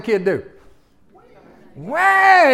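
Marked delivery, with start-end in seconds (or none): 1.8-2.5 high, loud mock bawling; child's tantrum wail. Child denied candy at checkout, mock tantrum wail. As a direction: wail like a thwarted little kid. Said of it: "he pitches a fit".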